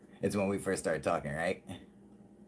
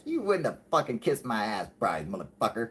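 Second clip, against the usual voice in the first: ordinary talk, nothing marked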